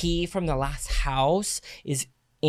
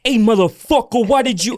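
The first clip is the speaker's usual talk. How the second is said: louder, higher furious agent snapping; hammed-up angry call from a wronged agent; mock furious agent yelling; 7 semitones higher, 7 dB louder than usual. Angry agent confronting showing agent.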